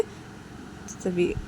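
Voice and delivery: ordinary delivery, nothing marked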